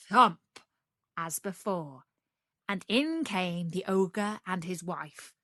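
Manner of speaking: ordinary delivery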